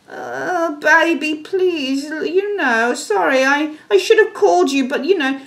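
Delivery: high, coaxing little apology; bashful pleading partner. Retold or imitated. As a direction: sheepishly wheedle forgiveness. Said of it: "look how he trots so bashfully".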